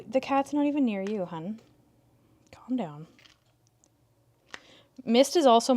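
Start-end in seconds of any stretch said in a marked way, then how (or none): 2.5-3.1 soft whisper to settle her; whispered calming. Real, not acted. Calming the upset cat near the kittens in a whisper.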